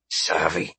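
ordinary delivery

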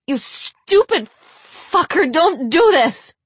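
slow, high, loud shrieking. A narrator's reading. Blonde begs the priest to stop, shrieking. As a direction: shriek it desperately, drawing out the words. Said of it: "the blonde shrieked".

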